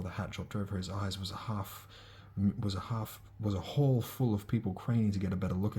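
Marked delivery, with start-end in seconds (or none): none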